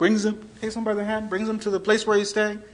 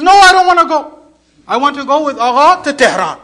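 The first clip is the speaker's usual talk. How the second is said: high, loud plea to stay; insistent refusal; 9 semitones higher, 17 dB louder than usual. Retold or imitated. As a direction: protest like someone refusing to go. Acted man refuses to leave.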